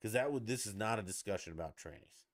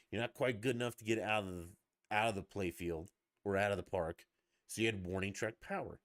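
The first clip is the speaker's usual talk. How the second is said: ordinary talk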